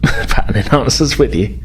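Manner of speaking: ordinary talk, nothing marked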